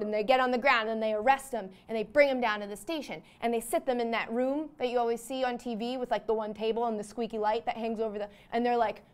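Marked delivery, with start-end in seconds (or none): none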